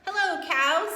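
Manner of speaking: chirpy duck saying hello; cheery duck. Acted. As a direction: call out a bright hello as Duck. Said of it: "said Duck".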